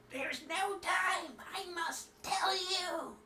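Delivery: high, slow, strained dying cry. Acted. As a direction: gasp it out like your last breath. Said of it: "in the dying whis-, or voice".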